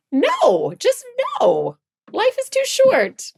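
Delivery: high, flat-out exasperated no; exasperated refusal. Retold or imitated. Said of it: "I was just like".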